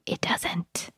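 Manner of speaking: whispered emphatic it doesn't. Real, not acted. Host reassuring parents that it needn't be perfect, whispered. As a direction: drop to a whisper to drive it home. Host softly insists on point.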